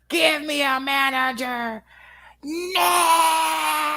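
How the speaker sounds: high mock scream, drawn out slowly; screaming customer. Retelling a customer demanding a manager, screaming. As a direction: build to a long hollered last word.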